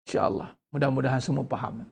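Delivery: soft, low, hushed mumbling; mock meek teacher. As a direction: imitate a passive teacher avoiding any argument. Acting out a teacher staying silent.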